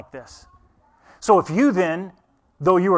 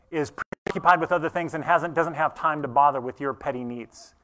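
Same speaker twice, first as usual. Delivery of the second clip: normal talking voice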